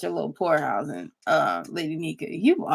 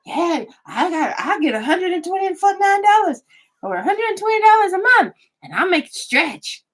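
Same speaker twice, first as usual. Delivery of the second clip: mocking imitation; 10 semitones higher than usual. Mocking the caller's words.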